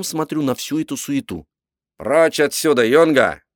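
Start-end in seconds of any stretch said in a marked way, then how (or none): 2.0-3.4 loud, higher-pitched command; shouted order. A narrator's reading. Captain chases the cabin boy away, shouting. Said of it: "крикнул он мне".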